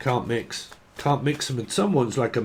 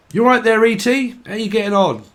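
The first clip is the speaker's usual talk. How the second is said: chirpy greeting; 9 semitones higher, 6 dB louder than usual. Retold or imitated. Greeting voiced in bit.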